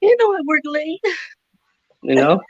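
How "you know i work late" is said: high mock soap-opera wife; high-pitched telenovela parody; telenovela actress. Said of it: "commentary on a telenovela".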